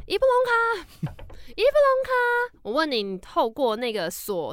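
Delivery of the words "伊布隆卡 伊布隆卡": much higher, slow drawn-out calling of the name; ghostly high call. Acted. The night visitor calling to Ibolyka, high slow ghostly wail. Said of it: "key太高了".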